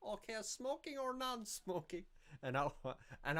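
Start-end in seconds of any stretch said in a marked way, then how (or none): 0.0-2.0 very high put-on waitress voice; sing-song, high-pitched mock greeting to diners; Jana. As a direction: mimic a foreign waitress in a squeaky-high, drawn-out voice. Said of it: "Jana used to talk like this".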